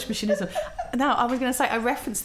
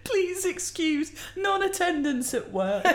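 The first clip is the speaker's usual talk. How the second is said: higher, slow, prim note-reading; formal sick note voice; 9 semitones higher, slower than usual. Pretend. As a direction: read it like a prim excuse letter.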